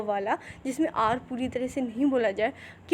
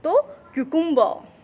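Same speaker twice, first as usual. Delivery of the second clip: ordinary talk, nothing marked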